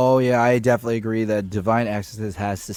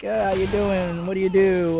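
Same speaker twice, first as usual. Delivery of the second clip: nervous, higher small-talk voice; awkward blind date voice; 7 semitones higher than usual. Retold or imitated. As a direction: play a shy first date. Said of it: "the awkward conversations on a blind date".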